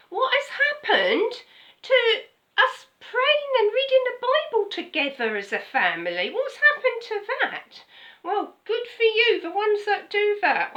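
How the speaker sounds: high, loud, near crying plea; impassioned preaching. Acted. Preacher pleads loudly over lost family prayer. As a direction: cry it out to the congregation.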